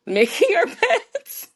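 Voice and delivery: giggling at her own admission; laughing. Real, not acted. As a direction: let the laugh carry the last words.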